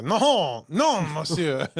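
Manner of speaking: slow hammed-up French accent; mock French agent, drawn out. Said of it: "the French agents meet with them first, and they're like".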